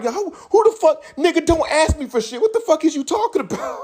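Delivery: very high, laughing squeal of outrage; outburst. Retold or imitated. Yelling at the broke friend who got offended, a laughing high squeal. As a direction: shriek it half laughing in disbelief. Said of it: "sorry, little outburst".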